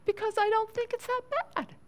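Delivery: high, fast, dismissive inner voice; a woman rationalizing her own sin; self-justifying thought. Retold or imitated. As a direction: toss off the excuse lightly. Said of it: "I think to myself".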